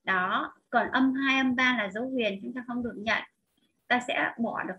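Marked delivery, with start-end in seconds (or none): none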